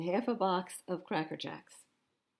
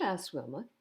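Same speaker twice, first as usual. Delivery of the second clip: ordinary delivery, nothing marked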